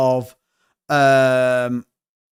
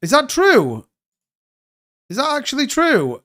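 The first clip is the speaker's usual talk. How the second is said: high, incredulous questioning; can't believe the news, pitch up; surprised disbelief; 10 semitones higher than usual. Real, not acted. Streamer reacting to the Trump pardon news, incredulous and high.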